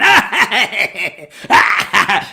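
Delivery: lightly mocked evil laugh; half-hearted evil cackle; villain laugh. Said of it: "Papa Palpatine laughing".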